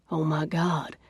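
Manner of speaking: soft, awed breath of words; breathed softly. Acted. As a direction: breathe it in wonder. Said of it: "Hunter breathes".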